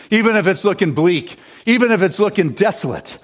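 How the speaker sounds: high, driving sermon voice; preaching at full force. Acted. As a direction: preach it to the whole congregation.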